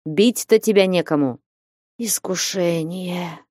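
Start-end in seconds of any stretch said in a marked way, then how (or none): 2.0-3.4 slow, soft sigh of a word; a hushed, sighing lament; sighing half-whisper. A narrator's reading. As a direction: breathe it out in quiet despair. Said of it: "с глубоким вздохом, полушёпотом".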